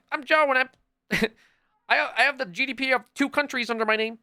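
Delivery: mock the bland rich guy in a silly high voice; mocking boring billionaire. Mocking a boring billionaire to friends, high and goofy. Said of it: "walking around like".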